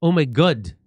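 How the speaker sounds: exaggerated Kiwi "oh my God"; mock New Zealand accent on "good". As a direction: imitate a New Zealander, hitting the last word. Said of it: "that's how they say".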